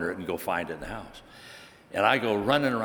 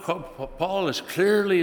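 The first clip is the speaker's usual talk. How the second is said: ordinary talk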